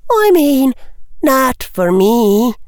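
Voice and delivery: put-on very high, slow character voice for a bashful girl; bashful girl's voice. A narrator's reading. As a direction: lift it high and draw it out, coyly. Tracy's blushing reply, voiced by narrator.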